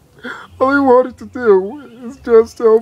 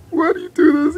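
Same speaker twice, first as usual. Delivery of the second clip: pitched up, pleading, stress on do; higher mock-tearful plea; 6 semitones higher, faster than usual. Begging people to spare the groundhog, higher tearful cry. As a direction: push the repeat up high like holding back tears.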